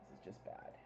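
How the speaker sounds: whispered dismay. Real, not acted. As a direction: say it under your breath. Dismayed at flooding.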